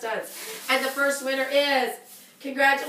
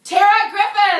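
loud, higher name call; excited shout; 8 semitones higher, 11 dB louder than usual. Real, not acted. Revealing the hundred dollar winner, loud excited shout.

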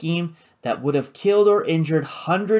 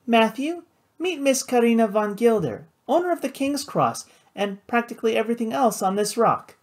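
high, grandly announcing feminine voice; playful hostess; 9 semitones higher than usual. A narrator's reading. Flora introducing her brother to Karina, playfully grand. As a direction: introduce someone with playful ceremony, pitched high.

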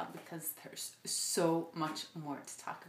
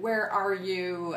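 slow, booming voice of God; thundering God; 7 dB louder, slower than usual. Retold or imitated. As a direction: call it out like a god thundering from above. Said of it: "God's voice thundered into the Garden of Eden".

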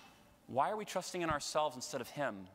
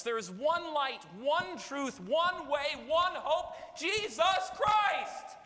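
climbing steadily higher and louder until Jesus Christ is shouted slowly; building to a shout; 8 semitones higher, 4 dB louder, slower than usual. Real, not acted. Priest to congregation, worked up, building to a shout. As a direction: start firm and build each phrase up to a full slow shout. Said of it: "I get a little worked up".

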